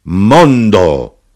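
loud, drawn-out cry; big ringing exclamation; shouted toast. A narrator's reading. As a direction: cry it out to the whole tavern. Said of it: "l'arrotino gridò".

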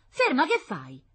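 high, loud cry to stop; alarmed yell. Acted. Wife rushes to stop the concierge, yelling in alarm. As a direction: cut in with a loud, alarmed shout.

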